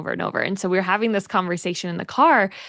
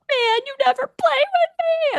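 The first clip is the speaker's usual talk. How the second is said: high-pitched mock child's whine; exaggerated pouty complaint, pitched way up; whiny and pouty; 3 dB louder than usual. Shown as a demonstration. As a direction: whine it like a sulking kid who got turned down. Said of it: "really whiny and pouty".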